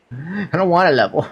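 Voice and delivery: whiny. Acted. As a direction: whine like a bored kid. Whiny gamer complaint.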